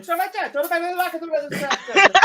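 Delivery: high-pitched mock Italian babble. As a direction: mimic the barman breaking into rapid Italian. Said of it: "then broke off midway to then".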